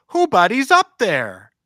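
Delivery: put-on high, loud, slow child voice calling again; drawn-out toddler call into the attic; imitating little daughter. Father imitating his daughter calling upstairs again, slow high child voice.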